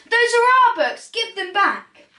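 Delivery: high, loud yell of protest; children shout at the book thief. Acted. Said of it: "the children yelled".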